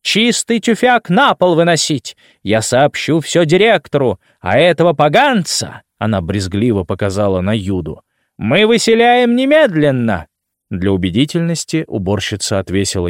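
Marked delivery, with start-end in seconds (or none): 0.0-5.8 high, shrill scolding woman; shrill screeching bird woman. Acted. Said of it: "пронзительно заверещала администраторша". 8.4-10.3 high, shrill shrieking eviction; shrill screeching bird woman. Acted. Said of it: "пронзительно заверещала администраторша".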